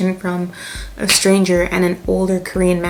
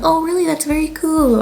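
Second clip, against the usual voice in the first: surprised reply; 8 semitones higher than usual. Retold or imitated. Surprised at Jamaican friends.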